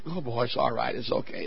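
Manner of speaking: very soft, low reassurance; murmured comfort to a son; soothing parents. Acted. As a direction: hush him gently, voice dropped right down. Nice parents reassuring their son.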